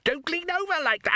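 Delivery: very high, urgent cry; panicked warning. A narrator's reading. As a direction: shriek the warning as control slips. Repeated warning, more panicked.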